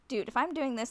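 usual speaking voice

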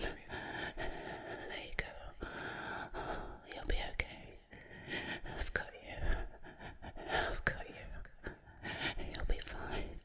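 soft eerie soothing whisper. Acted. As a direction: lull her gently to sleep. Soothing victim as she passes out.

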